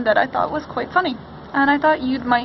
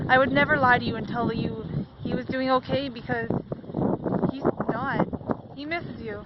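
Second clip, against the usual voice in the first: softening, near tears; 8 dB quieter than usual. Real, not acted. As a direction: voice drops much softer, sincere and sad. Confessing Keith is not okay.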